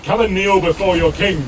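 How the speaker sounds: booming warrior king. Acted. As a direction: order them to kneel like a warrior king. King commands kneeling.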